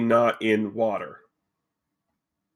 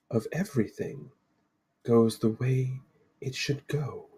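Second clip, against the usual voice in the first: mysterious Lady of the Lake; 6 dB quieter than usual. Acted. Lady introduces herself to four adventurers, solemnly mysterious. Game master voices the Lady.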